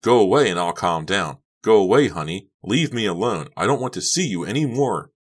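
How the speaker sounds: heated tirade, pitch climbing; furious dismissal. A narrator's reading. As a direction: drive her away, shouting. Furious quarrel, sending Honey away.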